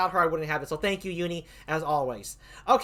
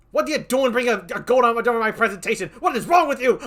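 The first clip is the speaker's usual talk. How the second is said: higher, louder, indignant; mock outrage; 5 semitones higher, 6 dB louder than usual. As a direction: scold the opponent in hammed-up disbelief. Mock outrage at goat image.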